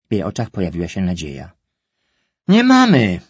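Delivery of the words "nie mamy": ucinające, głośne i wysokie „Nie mamy”, akcent na „mamy”; stanowcze nie. Acted. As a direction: utnij jej nadzieję jednym ostrym „Nie mamy”. Mother cuts off daughter's hope. (mama ostro ucina prośbę córki, głośno i wysoko)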